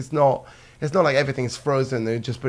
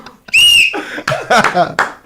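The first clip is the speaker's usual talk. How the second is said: high, loud laughing shriek; 9 dB louder than usual. Real, not acted. Laughing at his own joke.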